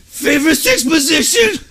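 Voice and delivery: high, slowed, dazed reply; mock delirious answer. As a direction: imitate the drowsy concussed cousin. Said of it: "He goes".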